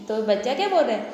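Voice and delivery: ordinary talk, nothing marked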